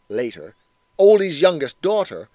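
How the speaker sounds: usual speaking voice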